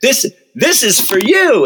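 high, slow, gushing offer; excited gift. Retold or imitated. Excited cashier handing over the magnet, high and slow. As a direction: present it like a grand gift. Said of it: "just gets all excited".

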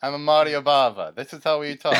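hammed-up mock Italian accent; terrible Italian accent. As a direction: lay on a bad Italian accent. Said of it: "terrible accent".

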